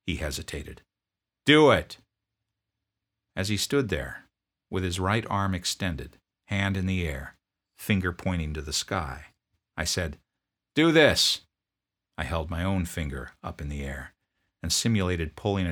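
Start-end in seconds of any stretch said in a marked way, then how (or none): 1.4-2.0 loud, higher, curt demand; drill sergeant. Retold or imitated. Drill sergeant confronting a sniffling private, loud bark. 10.7-11.4 loud, higher, curt demand; drill sergeant. Retold or imitated. Drill sergeant confronting a sniffling private, loud bark.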